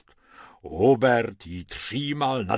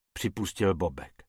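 ordinary delivery, nothing marked

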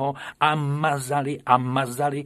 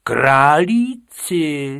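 slow, louder outraged question; teacher, louder and slow, shocked at rabbits; raised voice; 6 semitones higher, 8 dB louder, much slower than usual. A narrator's reading. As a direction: drag the word out in disbelief. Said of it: "zvýšil hlas pan učitel".

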